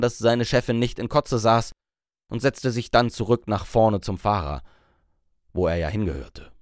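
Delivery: ordinary delivery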